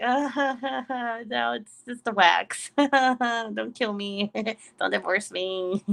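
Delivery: drawn-out mock pleading broken by giggles; slow, giggly retold begging; guilty laughing plea. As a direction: plead for mercy while cracking up. Retells guilty pleading through laughter.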